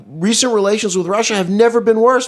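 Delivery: mock Trump boasting about Russia; hammed-up presidential bluster; Trump impression. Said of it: "but then he added".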